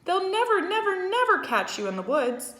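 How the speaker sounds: high, loud urgent cry; hollering goose. A narrator's reading. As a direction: yell encouragement. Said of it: "started hollering".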